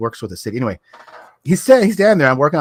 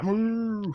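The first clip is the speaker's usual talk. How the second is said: mock cow moo; 11 semitones higher, 6 dB quieter than usual. Joke about cow tongue.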